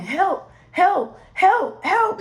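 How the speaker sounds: panicked calls for help, rising in pitch; screaming for help. Retold or imitated. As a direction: scream help like in a horror movie. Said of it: "And I'm screaming".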